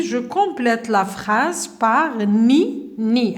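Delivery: slowly, word by word; slow instruction. Shown as a demonstration. Teacher reading the exercise instruction aloud, slowly. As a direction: read the instruction slowly for the class. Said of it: "Quatrième activité".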